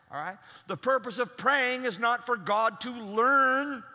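slow and loud, driving home each word; preaching emphasis. Acted. Preacher emphasizing prayer's purpose to the congregation, slow and strong.

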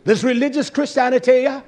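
loud, high condemning; angry denunciation. Real, not acted. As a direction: spit out the condemnation.

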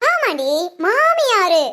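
drawn out, weighty மாமியாரு with a falling end; slow boastful stress on மாமியாரு; proud slow declaration. Acted. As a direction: lean hard on மாமியாரு, savouring it. Proudly declares her rank.

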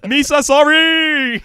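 slow loud wail; hammy shout. Pretend. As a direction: stretch it out loud. Hammy shouted movie quote.

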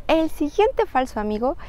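normal talking voice

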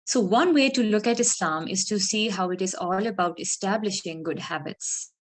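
normal talking voice

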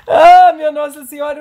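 loud exasperated cry; frustrated exclamation. Real, not acted. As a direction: vent the frustration.

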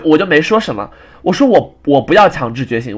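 normal talking voice

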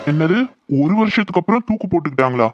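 high, startled disbelief; can't believe what she just heard; shocked question. Acted. Hearing shocking news of suicide. (அதிர்ச்சியுடன் கேள்வி)

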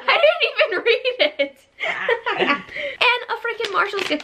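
high, laughing through words. Real, not acted. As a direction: read it while cracking up. Funny card read out.

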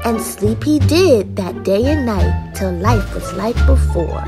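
ordinary delivery

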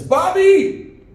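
high, loud sing-out of a name; calling out. Retold or imitated. Lady calls her son Bobby across the mall, high loud call.

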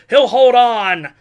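ordinary talk, nothing marked

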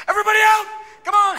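high, full-out mock alarm yell; urgent evacuation shout. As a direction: holler to clear the building. Acted evacuation of Senate office.

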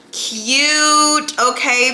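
high, loud drawn-out "cute"; stretched high praise; delighted. Real, not acted. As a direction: coo with delight. Admiring an item.

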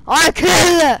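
loud high shouted taunt. Real, not acted. Gamer yelling at a guard to come closer, loud taunting shout. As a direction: yell it at the guard, playful bloodlust.

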